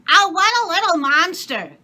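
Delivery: high, loud scolding maid; maid's voice. A narrator's reading. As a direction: exclaim like a shocked servant.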